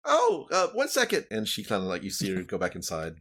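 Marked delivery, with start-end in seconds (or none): 0.1-1.3 high, fast little-woman voice; a small woman calling from her porch, high and quick; halfling woman. Acted. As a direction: peek out and call down in a squeaky voice. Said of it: "halfling woman speaks".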